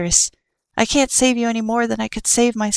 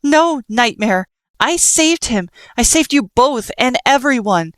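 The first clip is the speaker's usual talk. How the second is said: firm shouted warning; 6 semitones higher than usual. Acted. Frisk warning Nightmare while dodging attacks, raised voice. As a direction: stand your ground and warn him.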